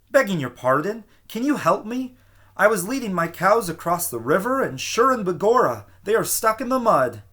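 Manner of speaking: high, urgent pleading little man with an Irish lilt; frantic plea. A narrator's reading. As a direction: beg for help, high and anxious.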